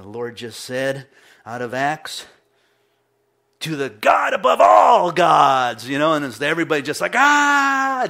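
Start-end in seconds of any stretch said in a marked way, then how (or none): 3.6-5.9 louder, slow, booming mock pulpit cry; hammed-up revival shout; mock preacher proclamation. Retelling the preacher's big proclamation. 7.1-8.0 mock crowd gasp. Imitating the crowd's amazed reaction.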